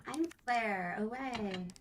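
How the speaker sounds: high put-on game heroine voice; Rebecca. Co-streamer playing Claire in the wrong voice, high Rebecca voice. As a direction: pitch it up, drawn out at the end. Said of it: "I'm using my Rebecca voice".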